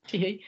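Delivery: high put-on tee-hee giggle; playful high giggle; mock giggle. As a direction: giggle it out like a coy schoolgirl. Playful giggle after laughing.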